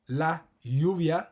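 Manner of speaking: lluvia said slowly as "yoo-vya", LL like Y; slow lluvia, the LL gliding; double l. Shown as a demonstration. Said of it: "because it's a double L".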